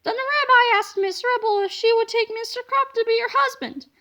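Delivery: ordinary delivery, nothing marked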